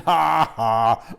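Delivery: loud brash guffaw; ha-ha at his own joke; hearty laugh. A narrator's reading. Uncle laughs loudly at his own joke.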